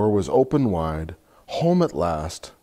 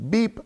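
truck beep; 13 semitones higher than usual. Acted. Truck honks in picture book.

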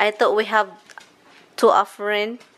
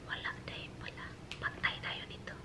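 whispered aside to the viewers; furtive whisper; 22 dB quieter than usual. Real, not acted. Vlogger confiding that the spray is gone, whispered. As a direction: whisper it like a secret problem.

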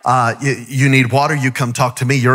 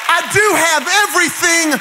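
preaching at full force; 6 semitones higher than usual. Acted. Preacher calling the church to praise, building to a shout. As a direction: preach it higher and higher to the crowd.

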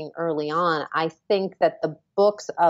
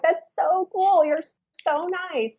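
gushing; 12 semitones higher, slower than usual. Retold or imitated. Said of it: "I was like".

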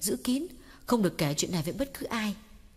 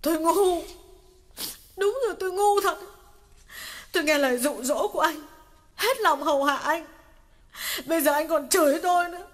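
higher, louder choking complaint; choked lament; 8 semitones higher, 5 dB louder than usual. Acted. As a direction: pour out hurt through tears. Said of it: "nghẹn ngào kể lể". (nghẹn ngào kể lể)

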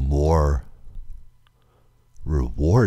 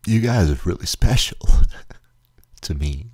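tearful thanks; 5 semitones higher, 5 dB louder than usual. Real, not acted.

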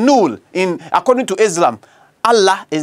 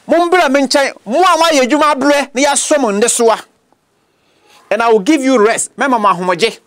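lifted, higher reading of Jesus's call; raised scripture voice; 5 semitones higher than usual. Acted. Preacher voicing Jesus calling the weary to come. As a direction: call them in gently but raised.

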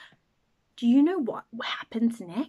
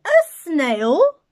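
much louder, higher, aghast; shocked teacher; 5 semitones higher, 13 dB louder than usual. Acted. Shocked teacher spotting the snail on the board, aghast. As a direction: gasp it out in disbelief.

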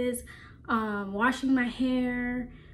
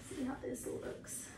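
whisper; 2 semitones higher, 15 dB quieter than usual. Real, not acted. Quiet aside while trying scarf.

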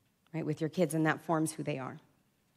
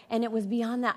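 usual speaking voice

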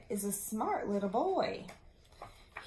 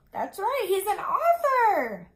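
high, loud cheer of praise; an excited teacher praising her pupils; 10 semitones higher, 11 dB louder than usual. Real, not acted. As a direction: celebrate the answer with the class.

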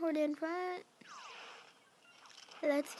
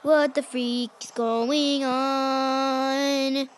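slow, loud frustrated rant; raging gamer; 2 semitones higher, 6 dB louder, much slower than usual. Real, not acted. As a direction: drag out the rage word by word.

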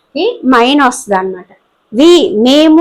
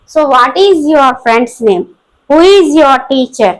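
slow dictation; much slower than usual. Shown as a demonstration. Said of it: "teacher reads example questions".

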